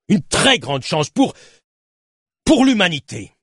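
voix haute, aveu emporté; confesse-toi avec véhémence, en montant jusqu'au dernier mot; justification enflammée. A narrator's reading. (plaidoyer enflammé)

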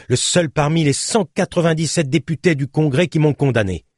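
dénégation vive, voix plus haute par moments; protestation véhémente. A narrator's reading. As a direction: proteste avec conviction pour défendre son protecteur. Le tueur contredit le policier. (protestation véhémente)

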